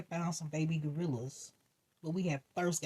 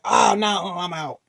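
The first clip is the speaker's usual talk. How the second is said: loud startled bail-out; alarmed exclaim; 3 semitones higher, 11 dB louder, slower than usual. Retold or imitated. Gorilla goes wild, loud alarmed escape. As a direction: shout it while fleeing. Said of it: "I was like".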